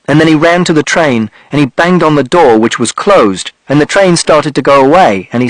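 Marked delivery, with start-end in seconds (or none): none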